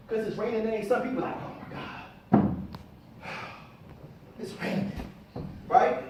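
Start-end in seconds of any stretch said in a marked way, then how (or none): 1.4-4.9 quiet, sighing complaint about the rain; mock weary sigh. Speaker mimics people groaning at the rain, sighing. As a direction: droop it like a letdown.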